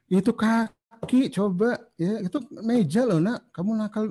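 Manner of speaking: high, slow, nagging scold in a put-on voice; mock scolding. Parent scolding child on the table, high mock nagging. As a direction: ham up a shrill nagging parent.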